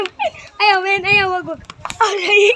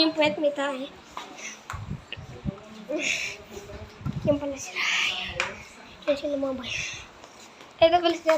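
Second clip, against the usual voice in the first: low, hushed commentary; 4 semitones lower, 12 dB quieter than usual. Real, not acted. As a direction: keep it hushed and close. Hushed narration of find.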